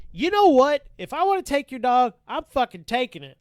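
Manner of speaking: loud, high-pitched angry outburst; pissed off. Retold or imitated. Officer finally snaps at dog owner, pissed off. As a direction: retell losing your temper at full volume. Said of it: "I just get pissed off".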